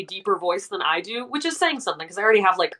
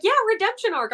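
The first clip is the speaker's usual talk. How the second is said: mock enthusiastic; 12 semitones higher, faster than usual. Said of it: "as much as I'm like".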